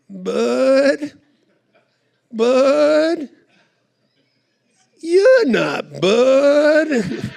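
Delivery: put-on high, very slow, puzzled old lady voice; a confused grandma peering at a stranger; old, confused woman. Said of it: "Think old and, very confused".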